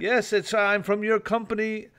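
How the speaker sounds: mimicking a scam caller with an Indian accent. Tells co-hosts about a scam call, mock Indian accent. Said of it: "very clearly a Pakistani Indian call center".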